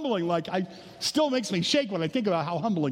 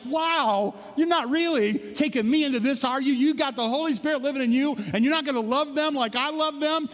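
a lightly voiced God, hurt and insistent; voicing God's hurt love; 8 semitones higher than usual. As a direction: plead as a wounded, loving God. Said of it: "it's like".

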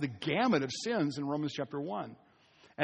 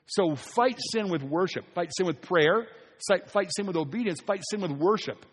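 louder, rising rallying preacher cadence; preaching exhortation; 3 semitones higher, 4 dB louder than usual. Acted. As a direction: drive each fight home to the congregation.

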